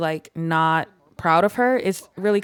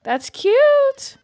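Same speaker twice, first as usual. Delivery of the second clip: very high, louder squeal; cute squeal; 5 dB louder than usual. Real, not acted. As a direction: gush over something adorable. Squeal at baby's visitor.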